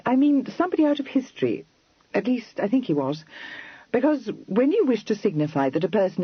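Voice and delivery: Kitty, pert and eager, reaching for a name; lightly voiced young lady Kitty. A narrator's reading.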